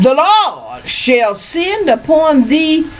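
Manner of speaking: building from slow and high to a shout; biblical preaching. Acted. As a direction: preach the curses up to a full shout.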